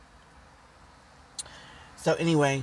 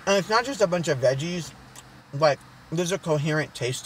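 talking while chewing; mouth partly full; 3 semitones higher than usual. Real, not acted. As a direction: hand near mouth. Seen 0: Bit food just before; fingers near chin and mouth, food held throughout.

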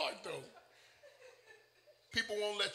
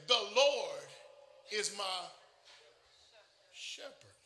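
The Lord, pause, is my, long pause, shepherd; dramatic pauses; slower than usual. Shown as a demonstration. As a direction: stretch the verse with pauses. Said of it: "this man said".

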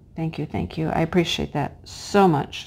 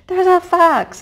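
high, loud call across the yard; yelling to warn of a fox; hollering for husband; 10 semitones higher, 5 dB louder than usual. Retold or imitated. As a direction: call out to Dale in alarm. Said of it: "I'm hollering".

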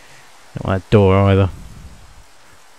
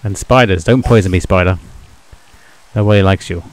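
ordinary delivery

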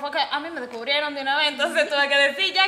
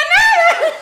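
giddy denial; 12 dB louder than usual. Retold or imitated. Denying to mom, giddy.

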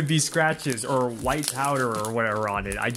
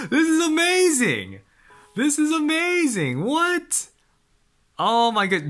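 much higher and louder, laughing with joy; delighted squeal; 4 dB louder than usual. Real, not acted. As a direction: shriek with fan delight. Amazed by the book pages.

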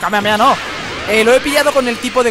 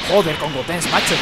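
ordinary delivery

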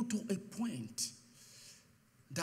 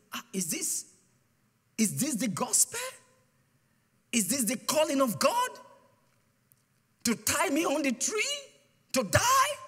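rising, louder anguished pleading; despairing cry; 7 semitones higher, 7 dB louder than usual. Retold or imitated. Tied to a tree in the forest, the preacher pleads with God in rising anguish.

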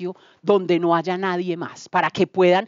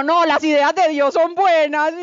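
excited; 9 semitones higher than usual. Retold or imitated. Answering her doubts with faith.

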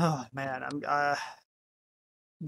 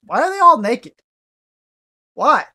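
higher, louder disbelief; exasperated; 6 semitones higher, 8 dB louder than usual. Real, not acted. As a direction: demand an answer, outraged. Indignant at naked ghosts.

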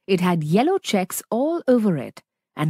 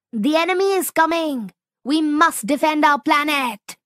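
high, fast, breathless warning; urgent alarm; 7 semitones higher than usual. Acted. Zap warning Whip the enemy is coming, high and hurried. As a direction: raise the alarm, rushing the words.

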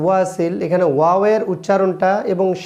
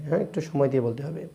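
quick unvoiced whisper; whispered aside; 3 semitones lower, 8 dB quieter, faster than usual. Real, not acted. Teacher whispering a reminder to take time. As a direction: breathe it quietly as an aside.

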